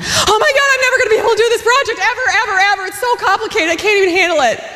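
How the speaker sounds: high, near-crying panic to husband; fast frantic whine, voice breaking; overwhelmed wail. Retold or imitated. Wife wailing to husband, high frantic near-tears. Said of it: "oh, I was overwhelmed".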